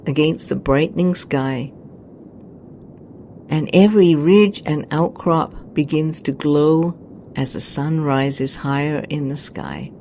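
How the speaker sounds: normal talking voice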